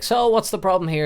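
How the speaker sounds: a doctor opening the consult; lightly voiced doctor. Doctor asks the problem.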